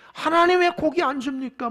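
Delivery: high-pitched imitation of a grumbling crowd; mock complaining. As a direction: imitate people whining up at God. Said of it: "울고불고 난리가 납니다". (원망하는 백성 흉내)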